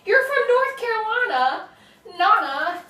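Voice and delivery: high and loud, thrilled to hear it; delighted. Real, not acted. Host reads a viewer's comment aloud, delighted. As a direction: gush over the comment.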